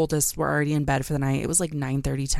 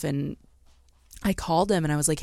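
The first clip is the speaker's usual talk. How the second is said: usual speaking voice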